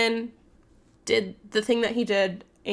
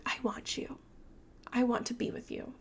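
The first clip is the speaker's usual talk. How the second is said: soft, breathy mock seduction; seductive whisper; 2 semitones higher, 11 dB quieter than usual. Woman plays up desire to trap vampire, mock seductive whisper. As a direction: purr it to lure him in. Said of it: "she tries to play, play it up".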